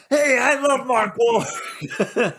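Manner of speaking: announcer parody. Retold or imitated. Mocking the announcer, laughing.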